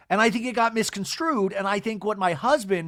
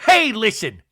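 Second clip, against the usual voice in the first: loud, higher mock confrontation; Angie impression; 6 semitones higher, 7 dB louder, slower than usual. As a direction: call her out at raised volume. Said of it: "Angie does her Angie move".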